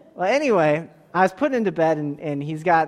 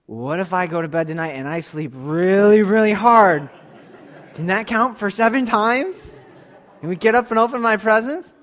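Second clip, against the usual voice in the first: put-on louder, laughing kid's plea; excited little boy; 3 semitones higher, 6 dB louder than usual. Dad voicing his excited son's bedtime plan, loud with laughter. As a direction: burst out with a giggling child's hope. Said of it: "This little boy is just so excited".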